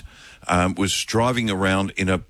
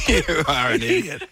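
giggling through the words; cracking up mid-sentence; laughing; 10 semitones higher than usual. Real, not acted. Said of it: "Corpsing, I think they call that".

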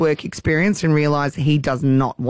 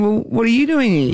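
put-on cheeky kid comeback; 6 semitones higher than usual. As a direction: fire the question straight back at him. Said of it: "And Jagger says to him".

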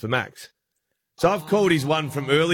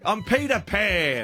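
playful fake name; 6 semitones higher, slower than usual. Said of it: "And I was like".

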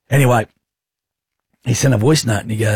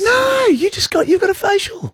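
mock falsetto. Teasing girlfriend in falsetto.

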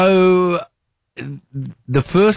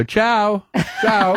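higher, lilting "Ciao, ciao" like an Italian; a playful Italian goodbye; mock Italian farewell; 9 semitones higher than usual. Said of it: "you just throw in a foreign word".

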